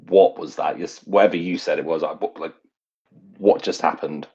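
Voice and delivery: ordinary talk, nothing marked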